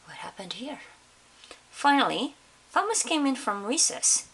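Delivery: ordinary delivery, nothing marked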